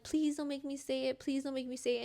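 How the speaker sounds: normal talking voice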